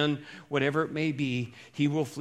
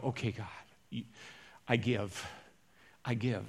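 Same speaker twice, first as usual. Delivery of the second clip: soft, lower, spent surrender to God; giving up; 7 semitones lower, 10 dB quieter than usual. Retold or imitated. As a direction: let it fall away quietly, like finally giving in. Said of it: "you finally get to the place and you go".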